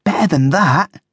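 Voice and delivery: high, astonished echo; taken aback, pitched high; gasped. A narrator's reading. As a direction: gasp it in disbelief. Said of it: "Coco gasped".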